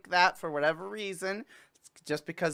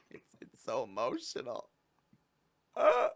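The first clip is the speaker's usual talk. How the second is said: mock crying; 3 semitones lower than usual. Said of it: "I got into character".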